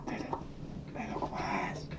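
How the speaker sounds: hushed whispered confession; whispered aside. Acted. Aside about goblin mothers.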